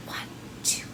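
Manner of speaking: hushed count under the breath; whispered counting. Acted. Librarian counting in the song for kids, whispered. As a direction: whisper the numbers like it's bedtime.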